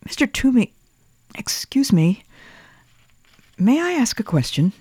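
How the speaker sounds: put-on high boy, partly whispered; child voice whispering. A narrator's reading. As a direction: ask timidly as a small boy. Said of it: "Thomas whispered".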